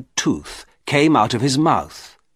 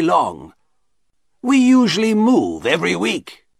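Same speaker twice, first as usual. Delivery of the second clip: high-pitched, deferential showman; shopkeeper explaining himself; 6 semitones higher than usual. A narrator's reading. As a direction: explain your troubles to a gentleman. Shopkeeper explains his troubles.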